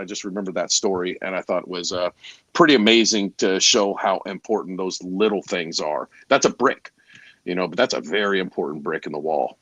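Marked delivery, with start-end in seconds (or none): none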